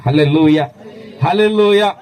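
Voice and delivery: booming hallelujah to the congregation; very loud praise shout; loud hallelujah call. Real, not acted. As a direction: belt it out to rouse the crowd. Preacher calls out hallelujah to congregation.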